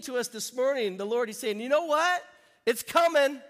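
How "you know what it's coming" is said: loud rising call of good news; excited proclamation. Retold or imitated. Pastor voicing the Lord telling the church it's coming, excited. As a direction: announce it like thrilling news.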